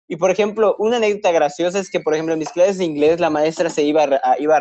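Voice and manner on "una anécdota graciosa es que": usual speaking voice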